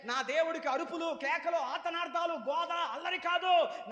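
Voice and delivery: high, loud, slowing at the end; preaching at full force. Real, not acted. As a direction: hammer it home to the church. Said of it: "preaching emphasis". (సంఘానికి గట్టిగా బోధిస్తూ)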